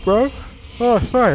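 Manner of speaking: mid-yawn, words stretched. Real, not acted. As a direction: talk through a yawn. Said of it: "I'm yawning again".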